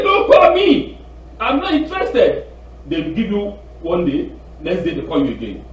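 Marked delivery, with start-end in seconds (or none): 0.0-2.5 high, loud brush-off; indignant retold snap, pitched up; exasperated refusal. Man refusing a scam caller, exasperated.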